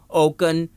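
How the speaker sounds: اوکن with a K in the middle; wrong K reading. Shown as a demonstration. Teacher shows how ocean is not read, K sound. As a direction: say اوکن as OH-kun. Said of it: "نہیں پڑھتے".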